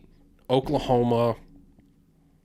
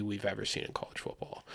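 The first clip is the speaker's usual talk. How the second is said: hushed emphasis; 3 semitones lower, 13 dB quieter than usual. Real, not acted. Builds up the hype of the crowd.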